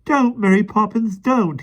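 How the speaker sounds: put-on high, pleading child's voice; pleading boy. A narrator's reading. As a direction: beg her to stop. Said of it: "he pleaded".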